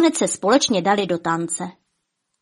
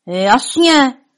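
loud, raised, proud reply; proud exclamation; 5 semitones higher, 7 dB louder than usual. A narrator's reading. Giant Vladimír shows off his costume, proud exclamation. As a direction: call it out proudly. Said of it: "zvolal Vladimír hrdě".

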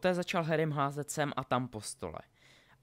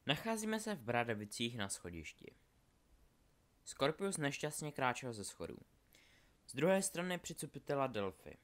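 ordinary delivery

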